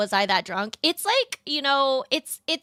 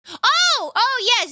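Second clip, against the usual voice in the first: much higher and louder, bright oh; sudden realization; 12 semitones higher, 5 dB louder, slower than usual. Real, not acted. Streamer suddenly recalls another drawing, in a high surprised voice. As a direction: remember it all at once.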